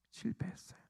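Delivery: barely audible, breath-only whisper; whispered repeat. Real, not acted. Preacher echoing the disciples' failure, whispered. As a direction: let it sink to a whisper.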